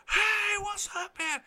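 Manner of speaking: high-pitched distant holler; imitated calling out. Fan greets player across the field, imitated calling out. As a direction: greet someone far off in a thin, high call. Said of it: "and he goes".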